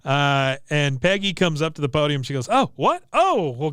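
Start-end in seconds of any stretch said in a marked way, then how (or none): 2.5-3.7 mock surprise. Peggy's mock surprise at award.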